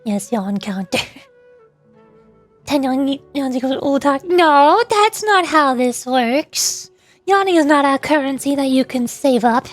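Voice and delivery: usual speaking voice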